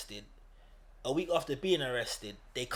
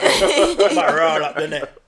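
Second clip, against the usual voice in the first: loud, slow laughing repeat; 11 dB louder, slower than usual. Real, not acted. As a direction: drag it out while laughing.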